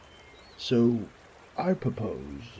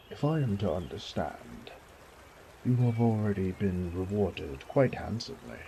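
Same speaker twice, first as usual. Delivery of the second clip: ordinary talk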